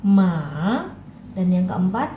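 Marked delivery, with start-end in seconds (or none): 0.0-0.9 ma dipping then rising; third tone ma. Shown as a demonstration. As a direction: dip ma and bring it up. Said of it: "turun kemudian naik".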